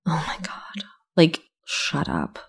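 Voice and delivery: breathy whisper, slowed; embarrassed whisper to yourself; whispered aside. Retold or imitated. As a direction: hiss it under your breath in embarrassment. Inner reaction at embarrassing friend.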